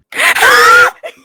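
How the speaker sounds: wheezing shriek of laughter; loud wheezing laugh. Real, not acted. Big laugh.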